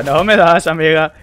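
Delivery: mock the enemy loudly; loud taunting. Real, not acted.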